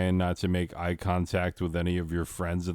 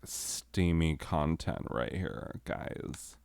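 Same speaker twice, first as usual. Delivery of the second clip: sultry narrator. Acted. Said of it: "that's not the voice".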